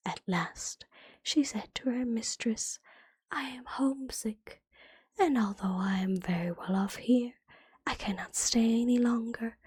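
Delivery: ordinary talk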